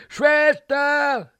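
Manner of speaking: high, loud cry for help; drawn-out desperate shout; terrified scream. Acted. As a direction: scream for the nurse, full of fear. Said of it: "laut geschrien, voller Angst".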